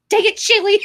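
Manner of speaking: high, loud mock-scolding yell; exasperated outcry. Reenacting a stumble blamed on Shaylee, exasperated outcry. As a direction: cry out as if the culprit just tripped you. Acted cry after losing balance.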